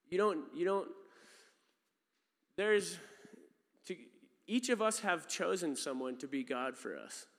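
ordinary delivery

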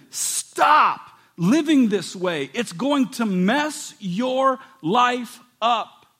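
slow, earnest pleading plea. Retold or imitated. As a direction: beg them slowly to stop. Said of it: "James is pleading with them".

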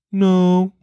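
put-on high little-kid voice, drawn out; pouty child. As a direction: imitate a sulky kid's answer. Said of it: "getting all pouty".

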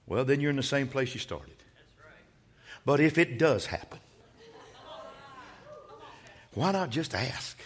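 very soft, low, spaced-out phrases; hushed preaching. Acted. Preacher asking the congregation why not ask, hushed preaching. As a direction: drop right down and let each question sit.

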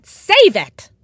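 high, loud cutting-in; irritated interruption. A narrator's reading. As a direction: cut them off loudly, fed up. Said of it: "Inez interrupted".

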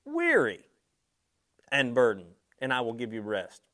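reading scripture with swelling emotion. Real, not acted. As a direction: lift WEARY with rising passion. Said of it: "the N I V says it like this".

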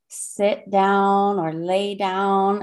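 slow, gentle meditation guide; soothing calm voice. Acted. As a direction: ease them into sitting down slowly. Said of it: "the soothing, calm voice of Lynn".

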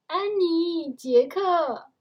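put-on high, loud call of the children's names; mother calling out. A narrator's reading. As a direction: call the kids home from far off. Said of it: "妈妈叫着".

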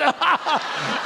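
short high burst of laughter. Real, not acted. Pastor laughing about the church sticker, high.

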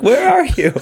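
high mock damsel call through laughter; Ashley impression. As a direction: call out squeakily, hammed-up and giggling. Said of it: "It's like".

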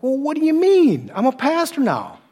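incredulous, high-pitched objection; indignant protest. Retold or imitated. Arguing with himself, indignant.

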